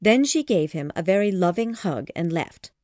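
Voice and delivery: plain reading voice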